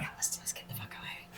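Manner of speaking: urgent hushed whisper. Real, not acted. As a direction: whisper it, scared of being heard. Sneaking away from a trap.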